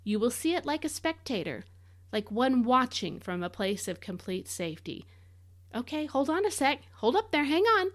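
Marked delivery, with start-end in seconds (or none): 5.7-7.9 excited interruption. Real, not acted. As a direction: halt everyone with rising excitement. Preacher stops himself, excited.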